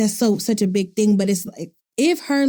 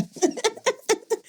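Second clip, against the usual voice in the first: high squealing laugh; 12 semitones higher, 6 dB quieter than usual. Real, not acted. Recalling juicy aunt kisses, high squealing laugh. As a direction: let out a high giggle.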